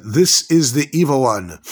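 slow, weighty declaration; dramatic pronouncement. Acted. Teacher quoting the verse on the Yetzer Hara, slow dramatic pronouncement. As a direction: stretch it out like a grave verdict.

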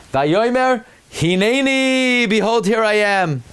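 a lightly voiced devoted Avraham; Avraham answering. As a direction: answer God with humble readiness.

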